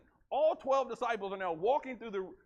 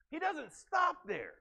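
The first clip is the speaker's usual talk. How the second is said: emphatic shout; 8 semitones higher, slower than usual. Real, not acted. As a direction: drive it home to the congregation.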